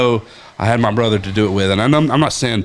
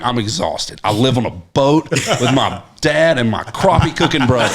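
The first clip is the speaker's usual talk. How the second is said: ordinary talk, nothing marked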